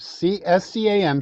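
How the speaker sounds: S C A M spelled letter by letter; spelling S C A M out for listeners. Shown as a demonstration. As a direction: spell each letter of S C A M clearly. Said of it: "website called Scam Baiters".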